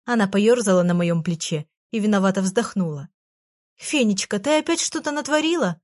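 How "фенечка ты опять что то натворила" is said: higher, alarmed gasp of a question. A narrator's reading. Girl alarmed at her fairy's mischief, gasping higher. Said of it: "ахнула я".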